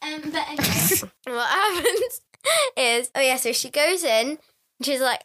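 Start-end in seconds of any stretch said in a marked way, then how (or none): none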